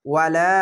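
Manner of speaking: "ওয়ালা" with the final লা held long; one-alif stretch. Shown as a demonstration. Teacher demonstrating the alif, "ওয়ালা" with long লা. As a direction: draw out the end of "ওয়ালা". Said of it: "এক আলিফ টেনে পড়বেন".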